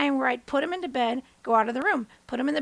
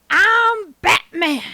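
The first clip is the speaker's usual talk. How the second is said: loud, drawn-out superhero declaration; child playing Batman; 5 semitones higher, 9 dB louder, much slower than usual. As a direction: announce it like a kid hero.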